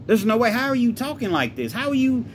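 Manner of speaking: high, loud, incredulous protest; furious disbelief. Acted. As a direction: refuse to believe it, voice pitched right up. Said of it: "like I said he's furious".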